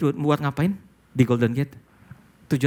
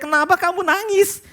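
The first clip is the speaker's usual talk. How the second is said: high caring question; 3 dB louder than usual. Retold or imitated. Question nobody asked him.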